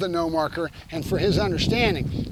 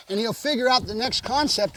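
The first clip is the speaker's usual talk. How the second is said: usual speaking voice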